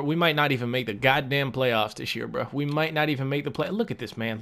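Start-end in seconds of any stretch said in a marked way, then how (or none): 3.7-4.4 soft, under-the-breath look at this; muttered disbelief. Real, not acted. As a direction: grumble it to yourself. Muttering at a bad player.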